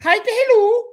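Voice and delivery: very high, loud squeaky cartoon-mouse voice; Mickey Mouse voice. Host imitates German lady learning English, squeaky Mickey Mouse voice. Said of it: "picture her talking like Mickey Mouse".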